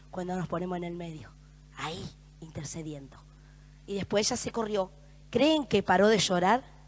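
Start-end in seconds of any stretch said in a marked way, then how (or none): 0.1-3.2 whisper. Acted. Sermon drops to hush.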